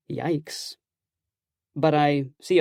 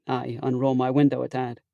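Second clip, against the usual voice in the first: ordinary delivery, nothing marked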